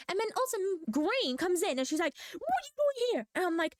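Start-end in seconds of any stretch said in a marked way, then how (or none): 2.3-3.3 high, sharp mock demand; mock teacher challenge. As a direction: imitate a stern teacher catching her out. Teacher confronts her, retold.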